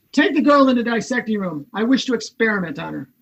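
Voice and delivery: put-on quick, clipped-off command to dissect the girl; brisk cold order. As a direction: rattle it off like a routine lab order.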